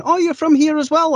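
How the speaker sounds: put-on Scottish accent asking a question. Scot asks the voice actor if he is local, in a Scottish accent. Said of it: "and they'll be going".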